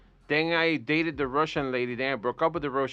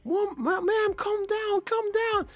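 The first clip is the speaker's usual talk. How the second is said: high, slow mock police plea; pleading cop; slower than usual. Storyteller voicing police pleading with knife-wielding woman, high slow mock plea. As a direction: beg her to calm down like a rattled cop. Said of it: "the police are like".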